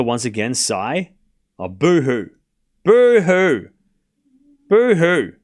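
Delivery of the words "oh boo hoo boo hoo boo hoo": mock boo-hoo. Mocking chat for complaining.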